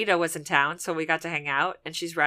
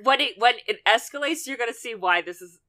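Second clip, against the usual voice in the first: laughing, louder excited teasing; fast, louder gleeful warning; titillated; 5 semitones higher, 7 dB louder, faster than usual. Real, not acted. Teasing how scary show gets.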